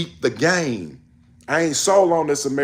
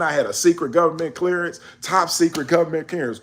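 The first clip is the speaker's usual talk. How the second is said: ordinary talk, nothing marked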